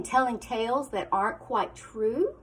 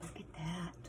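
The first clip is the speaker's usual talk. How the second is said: hushed aside; 7 semitones lower, 19 dB quieter than usual. Real, not acted. Teacher murmuring to children about the picture. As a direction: point quietly at the picture.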